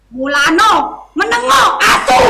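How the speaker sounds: furious cursing yell; angry shout. Acted. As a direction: spit it out loud. Officer cursing the frightened people.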